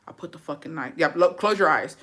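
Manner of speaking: ordinary delivery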